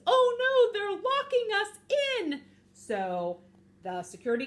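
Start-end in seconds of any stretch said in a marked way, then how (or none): 0.1-2.4 high, loud cartoon panic; hammed-up high-pitched dismay; panicked cry. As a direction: wail out the alarm as if getting trapped. Character panics as door closes.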